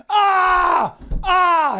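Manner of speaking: high, loud disgusted scream; retold scream. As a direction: yell in horror at the plate. Guy yelling at shit plate.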